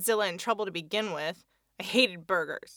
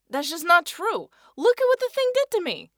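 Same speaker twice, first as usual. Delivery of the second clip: indignant denial; 10 semitones higher, 9 dB louder than usual. Acted. Eddie denies, indignant.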